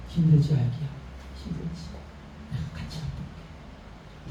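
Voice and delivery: fading into a soft whisper; softly soothing to a baby; tender whisper. Retold or imitated. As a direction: comfort a crying baby, barely breathing it. Jesus soothing a suffering child.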